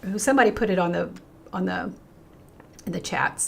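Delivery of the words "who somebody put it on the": ordinary delivery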